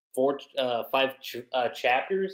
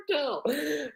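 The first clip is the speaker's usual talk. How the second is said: high squealing laugh on the name; 12 semitones higher, 7 dB quieter than usual. Real, not acted. Vlogger laughing at his own Naruto joke, high squeal.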